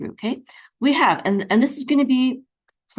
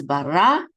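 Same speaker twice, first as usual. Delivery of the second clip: "bara" with the accent on the final "ra"; stress on last syllable; 2 semitones lower, 4 dB louder than usual. Shown as a demonstration. Teacher shows where the accent falls, final stress. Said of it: "accent is on the last syllable".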